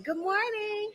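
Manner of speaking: high, soft, gentle good morning; soft teacher voice. Shown as a demonstration. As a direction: coo it to little children. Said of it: "Do your teacher voice".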